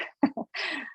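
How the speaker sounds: short, soft high-pitched laugh. Real, not acted. Presenter thanking audience for hanging in there, short laugh.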